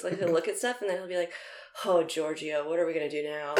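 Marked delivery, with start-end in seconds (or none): 1.8-3.5 softer, lower muttering to himself; lightly voiced old plumber mumbling; talking to himself. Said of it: "he talks to himself".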